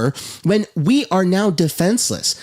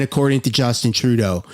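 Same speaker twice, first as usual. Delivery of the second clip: ordinary talk, nothing marked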